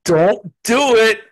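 mock warning. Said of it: "he's all".

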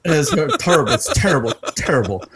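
laughing. Real, not acted. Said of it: "That's terrible".

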